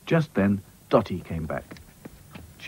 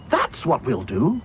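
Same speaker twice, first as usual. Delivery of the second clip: 1 Dotty's voice; 6 semitones higher, slower than usual. Acted. Dotty decides her plan.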